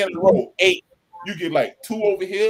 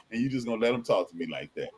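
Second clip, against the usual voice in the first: hushed indignation; 6 semitones lower, 8 dB quieter, much faster than usual. Retold or imitated. Wife's look voiced.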